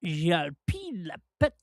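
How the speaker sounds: a hint of a French accent, gibberish French; mock French accent, lightly done. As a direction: toss off fake French in a jokey French accent. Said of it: "he talks like a French".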